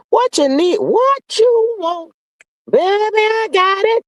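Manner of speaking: high, slow cooing offer; sing-song it like a too-eager cousin; syrupy high voice of a relative offering help; mock sweet relative.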